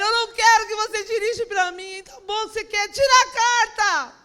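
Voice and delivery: high, loud protesting quote; hammed-up heated refusal; upset outburst. Acted quarrel with husband.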